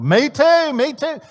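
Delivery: high, loud, insistent agreement; mock-eager wife's outburst; eager wife chiming in.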